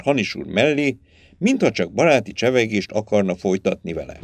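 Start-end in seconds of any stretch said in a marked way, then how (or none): none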